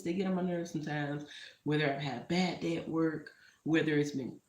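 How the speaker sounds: ordinary talk, nothing marked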